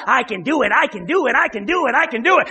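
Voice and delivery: mock self-psyching. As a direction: parody someone psyching himself up, chanting it. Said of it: "started psyching himself out".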